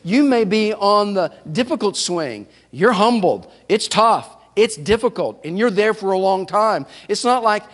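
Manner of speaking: ordinary talk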